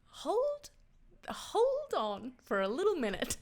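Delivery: high, drawn-out mock "hold on"; mock alarmed halt. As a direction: stop him mid-sentence, mock-stunned. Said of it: "I'd be like".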